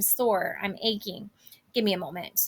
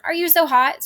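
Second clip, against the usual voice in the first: scolding; 6 semitones higher, 5 dB louder than usual. Acted. Nurse scolding impatient Juliet.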